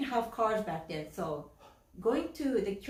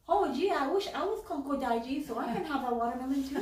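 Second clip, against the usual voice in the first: put-on higher-pitched envious kid whine; imitated little-boy whine; whining; 5 semitones higher than usual. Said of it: "whines even more and says".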